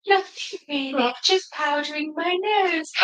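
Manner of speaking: dainty lady bear, taking her time; Elsa Bear voice. Acted. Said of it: "which voice to do".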